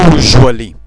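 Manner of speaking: "usually" with a Romanian j in the middle, said loud and low; j sound in the middle of "usually"; English zh sound. Shown as a demonstration. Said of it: "se pronunță j". ("usually" cu j la mijloc)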